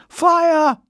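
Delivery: high, loud alarm call; panicked man calls out in the station. Acted. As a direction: cry Fire! across the crowd. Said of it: "One man even began calling out".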